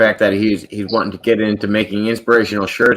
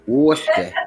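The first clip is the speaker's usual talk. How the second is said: Boston accent; 6 semitones higher than usual. Shown as a demonstration. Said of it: "You gotta do it with the Boston accent".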